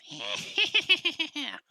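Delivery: high, loud imitation of a cackling little creature; weasel-creature cackle. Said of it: "that little weasel thing that goes".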